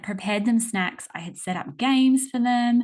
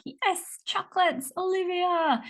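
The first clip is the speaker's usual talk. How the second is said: put-on excited little girl; 11 semitones higher than usual. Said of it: "she'd be like".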